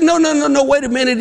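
very high-pitched, flustered plea; mock-desperate protest. Counselor stopping the jumper, hammed-up desperation. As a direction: rattle off the no's high and urgently. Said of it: "he's really desperate".

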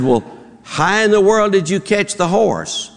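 usual speaking voice

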